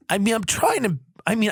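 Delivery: ordinary delivery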